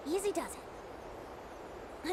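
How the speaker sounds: slow, breathy-soft reassurance; soothing hush. Acted. Bard soothing a nervous flyer, slow hush. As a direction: ease the traveler along gently. Coaxing a flyer through wind.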